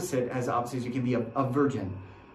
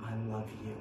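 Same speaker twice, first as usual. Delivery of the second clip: much softer, tender "I love you"; 6 semitones lower, 12 dB quieter than usual. Acted. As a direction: breathe the words gently to one person. Jesus's eyes saying to listener.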